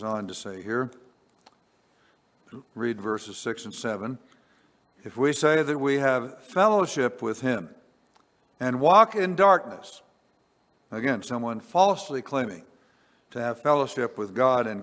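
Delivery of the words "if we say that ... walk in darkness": rising to higher, louder "walk in darkness"; scripture reading. Acted. Preacher reading a warning verse to congregation, building louder. Reads verses six and seven.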